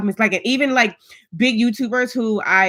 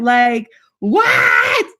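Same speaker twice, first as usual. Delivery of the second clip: a real burst of excited disbelief, a little louder; 2 semitones higher, 4 dB louder than usual. Real, not acted. Telling viewers about the upload milestone, excitedly shouting.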